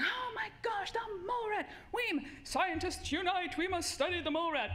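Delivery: hammed-up scientists rallying the troops; squealing scientist fanclub; mock excited scientists. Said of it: "all the researchers in the world are going".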